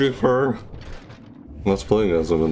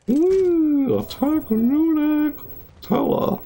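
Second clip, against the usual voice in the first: much higher, shouted cheer; rally the team with a high whoop; excited whoop; much slower than usual. Real, not acted. Cheering match start.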